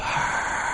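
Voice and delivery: long soft hushing noise; imitated distant roar of a crowd; seashell roar. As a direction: breathe out a steady rush like a seashell held to the ear. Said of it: "like somebody put a seashell in my ear".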